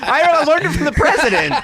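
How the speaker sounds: laughing through the words, high. Real, not acted. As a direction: say it while laughing hard.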